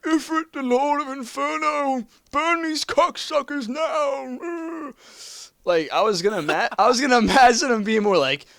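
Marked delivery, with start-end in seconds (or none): 0.0-4.9 put-on high, slow croaking old man; mock old man voice. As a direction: wheeze it out like a feeble old man. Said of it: "He sounds like a fucking old man".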